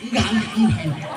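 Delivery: high, quick flustered "enggak"; flustered refusal. Acted. Fake lady flustered, telling the women to stop. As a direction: brush them off in a fluster.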